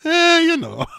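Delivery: put-on very high, laughing knowing old-man voice; a sly nudge with a chuckle; cheeky old man. An old Italian resident joking about mating frogs, laughing.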